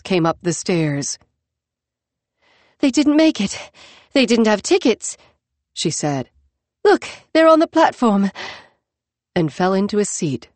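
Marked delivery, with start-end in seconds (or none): 2.8-5.2 panting after the run; out of breath. Acted. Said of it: "her chest heaving". 6.8-8.3 breathless, higher and louder; panting after the run; out of breath. Acted. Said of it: "her chest heaving".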